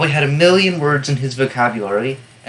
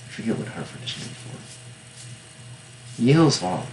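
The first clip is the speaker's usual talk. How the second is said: ordinary delivery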